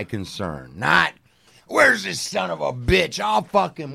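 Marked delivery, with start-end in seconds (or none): 1.7-3.9 high, heated outburst; mock angry father. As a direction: act out a dad raging at the culprit.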